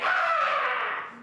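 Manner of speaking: very high, loud shriek of horror; hammed-up teacher's scream; mock scream. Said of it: "and she went".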